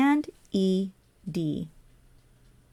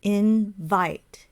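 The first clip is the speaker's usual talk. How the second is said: "Invite" drawn apart, soft in, loud vite; soft then loud. Shown as a demonstration. Teacher demonstrating stress to learners, "Invite" soft then loud. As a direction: separate "Invite" and hit the vite. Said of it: "soft and then loud".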